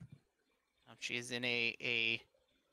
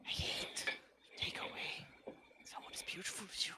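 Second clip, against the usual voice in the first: hushed, rushed confession; regretful whisper; 6 semitones higher, 4 dB quieter, faster than usual. Acted. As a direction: whisper it fast under your breath. Fey confesses under her breath.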